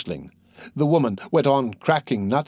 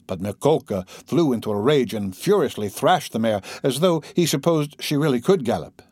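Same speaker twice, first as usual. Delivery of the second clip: usual speaking voice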